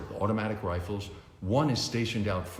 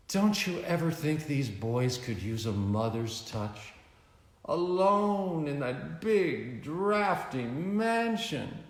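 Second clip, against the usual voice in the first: lightly higher, teasing lady's voice; flirty socialite; 7 semitones higher, slower than usual. A narrator's reading. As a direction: coo at him like a seductive heiress. Veronica flirting with Bruce.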